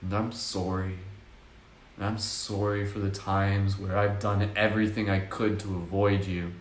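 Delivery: whisper-soft reassurance to a hurt part of you; tender apology. Shown as a demonstration. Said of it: "And just be like".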